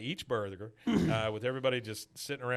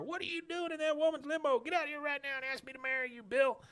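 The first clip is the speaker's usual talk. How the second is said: high-pitched imitation of angry hollering; hammed-up high yell; mock yelling; faster than usual. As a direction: holler from outside a car, pitched way up without full volume. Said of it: "you hear Lenore banging on the outside".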